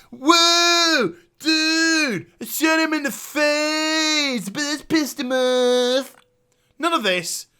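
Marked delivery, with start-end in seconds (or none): 0.1-6.2 higher, slower jokey sidekick voice, different timbre; mocking Liam. Liam quipping in the player's ear, mock dude voice. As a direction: ham up a dopey bro sidekick. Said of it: "no fucking Liam in his ear going".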